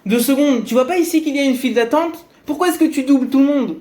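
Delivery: high, fast angry challenge; big man shouting, fast and high; angry yell at queue-jumper. Retold or imitated. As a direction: snap at him for cutting the line. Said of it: "qui lui crie dessus".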